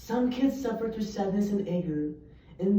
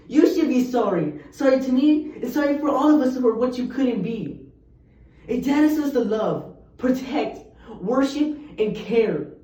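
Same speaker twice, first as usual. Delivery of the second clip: angry accusation; 4 semitones higher, 8 dB louder than usual. Acted. Poem climax accusing the father.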